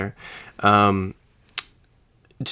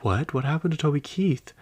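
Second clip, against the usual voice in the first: retold gasp of surprise, higher; shocked disbelief; 7 semitones higher than usual. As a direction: pitch jumps higher in stunned disbelief. Retold shock at coworker's news.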